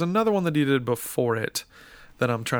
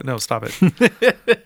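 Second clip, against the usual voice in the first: laughing; 6 dB louder, much slower than usual. Real, not acted. Laughing at cohost's joke.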